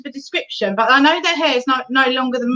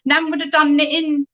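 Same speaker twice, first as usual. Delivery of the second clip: ordinary delivery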